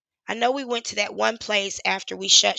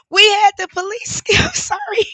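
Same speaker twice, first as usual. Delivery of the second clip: much higher and louder, crying out; breaking into tears; 10 semitones higher, 7 dB louder than usual. Real, not acted. Retelling the police-steps night, breaking into tears. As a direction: voice cracks into a sob.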